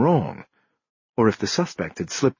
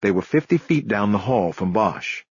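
ordinary delivery, nothing marked

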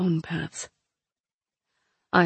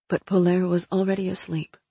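usual speaking voice

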